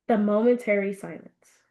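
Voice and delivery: ordinary delivery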